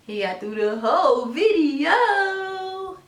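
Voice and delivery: high, very slow proud praise. Real, not acted. Praising baby, repeated.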